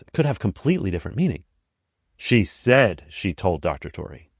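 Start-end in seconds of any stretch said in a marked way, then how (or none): 2.2-4.3 SAID drawn out and punched, casting doubt; stress on SAID. Shown as a demonstration. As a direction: lean hard on "said", as if she didn't actually. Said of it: "implying that she didn't actually say it".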